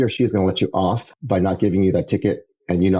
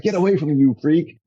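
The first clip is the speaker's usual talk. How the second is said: freaked-out friend; 9 semitones higher than usual. Retold or imitated. Friend recoiling in fear.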